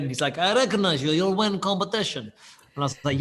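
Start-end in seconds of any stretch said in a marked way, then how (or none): 0.4-2.2 mock Russian accent, higher pitch; imitating a Russian challenger, broken English; Russian guy. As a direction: put on a thick Russian accent. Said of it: "this Russian guy comes in".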